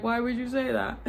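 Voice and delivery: hurt wail, half-laughing at it now; mock-tearful why would you; crying protest. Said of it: "made me cry even more".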